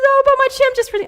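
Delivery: put-on high, loud, rushed little-girl wail; whining child. Daughter explaining herself to mother after her tantrum, mock child whine. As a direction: imitate a child's squealed excuse. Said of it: "She goes".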